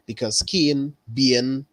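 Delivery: ordinary delivery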